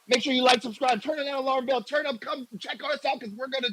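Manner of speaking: high, hyped-up plug for the channel; imitating Boo. Host tries to sound like Boo pushing subscribe, high and hyped. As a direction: pitch it way up and sell it like Boo. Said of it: "I was trying to be Boo".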